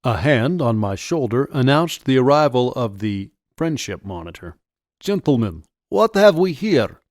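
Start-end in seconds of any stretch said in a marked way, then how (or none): 5.0-7.0 put-on Russian-accented officer, rising high at the end; heavy Russian accent. A narrator's reading. Soldier surprises the teens, in a heavy Russian accent. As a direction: arrive as a Russian-accented monitor. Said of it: "asked in a heavy Russian accent".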